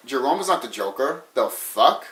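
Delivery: low, quiet mock disbelief; dropped-voice, incredulous fan reaction; baffled fans. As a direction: imitate a fan muttering in confusion. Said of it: "other people will be like".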